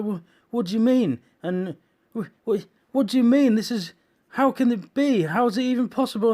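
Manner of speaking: high, loud stammering panic; frantic, disbelieving questions; wife panicking at the tsunami news; panicked wife. Retold or imitated.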